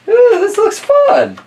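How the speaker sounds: high, slow, delighted ooh; excited. Real, not acted. Opening the book for the kids, high delighted squeal. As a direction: gush over the new book.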